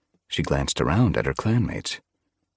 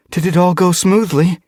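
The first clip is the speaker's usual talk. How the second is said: put-on eager young voice; 13 semitones higher, 7 dB louder than usual. Eager young cat asks.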